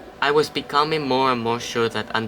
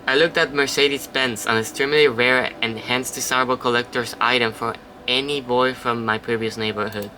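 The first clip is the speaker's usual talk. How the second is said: ordinary talk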